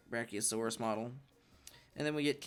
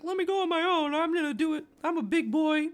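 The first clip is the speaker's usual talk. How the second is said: put-on much higher, louder childish insistence; whiny Ace; 9 dB louder than usual. Ace insisting to Whitebeard, high loud whiny voice. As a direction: whine it like a kid who swears he's grown. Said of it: "Ace is like".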